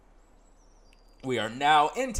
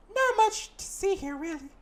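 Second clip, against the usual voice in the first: put-on bright girl guide voice, much higher; high companion voice; 3 dB louder than usual. Companion greeting the player in town, high voice. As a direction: play the chirpy companion up high.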